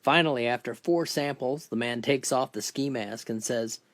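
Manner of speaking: ordinary talk, nothing marked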